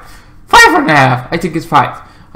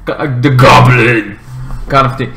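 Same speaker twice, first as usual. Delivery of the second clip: usual speaking voice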